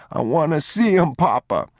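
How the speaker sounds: put-on high, whiny child plea; tearful little boy. A narrator's reading. Boy looking back as he's led away, tearful pleading. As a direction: beg Papa, voice pitched high. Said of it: "The boy wouldn't stop crying".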